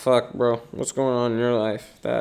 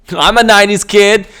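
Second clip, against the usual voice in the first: put-on nineties kid voice; 7 semitones higher, 10 dB louder than usual. Announcer-style nineties kid intro.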